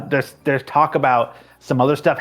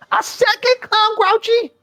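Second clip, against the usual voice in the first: much higher, stretched disbelief; incredulous squeal; slower than usual. Acted. Boss outraged at hiring a second clown, high squeal. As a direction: screech it in disbelief.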